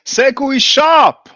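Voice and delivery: high, very loud, drawn-out call; hyped-up slogan. Pretend. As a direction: stretch it out like a crowd chant. Commentator hoots slogan between clips.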